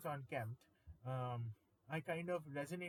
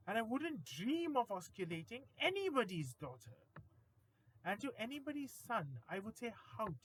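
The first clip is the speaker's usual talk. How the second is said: dramatic poem recitation; 4 semitones higher, 5 dB louder than usual. Acted. Careful cold sufferer scolds a careless one, mock-indignant recitation. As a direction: start measured, grow steadily more accusatory and emphatic.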